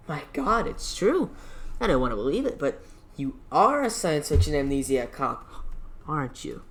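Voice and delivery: mock amazement. Union boss pretending shock at the amnesiac cop. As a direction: ham up the disbelief.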